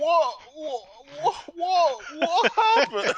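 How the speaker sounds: high, loud, repeated stunned whats; hammed-up stammer of disbelief; mock stunned. Imitating a man stunned by what he saw, mock dumbfounded. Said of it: "stunned beyond belief".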